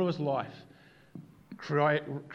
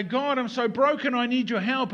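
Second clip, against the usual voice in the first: mock pleading; 9 semitones higher, 5 dB louder than usual. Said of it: "we come to God in our brokenness and we say".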